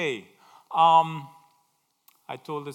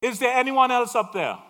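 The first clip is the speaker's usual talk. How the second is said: comic punchline; 8 semitones higher, 11 dB louder than usual. Acted. Punchline, man rejects God's answer.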